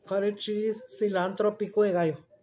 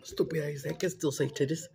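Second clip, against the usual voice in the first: whisper; 5 semitones lower, 5 dB quieter than usual. Real, not acted. Aside about daughter's teasing.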